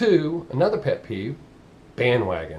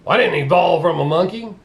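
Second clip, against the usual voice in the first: loud, higher-pitched indignant parody; mocking misquoter; 4 semitones higher, 6 dB louder than usual. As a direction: blurt it like a clueless objector. Mocking people who misquote Darwin.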